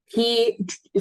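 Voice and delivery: normal talking voice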